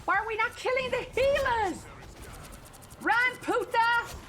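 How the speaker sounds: high, loud frantic yell; screaming. Real, not acted. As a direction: scream at the team in the heat of the fight. Screaming at teammates mid-fight.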